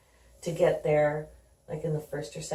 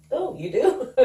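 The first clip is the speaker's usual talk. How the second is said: high, louder delighted surprise; retelling his surprised reply, pitched up; 8 semitones higher, 6 dB louder than usual. Retold or imitated. Son hearing girlfriend say I love you, surprised and pleased.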